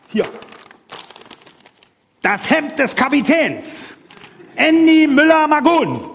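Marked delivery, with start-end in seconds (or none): 4.5-6.0 proud announcement. Real, not acted. Proud reveal of the name.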